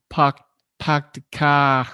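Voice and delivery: slow attempted Boston accent, r dropped in "car". Shown as a demonstration. Host guessing how East Coasters talk, halting Boston accent. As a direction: fumble slowly through a Boston accent. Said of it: "I think that's how they say it".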